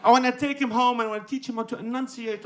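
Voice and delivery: normal talking voice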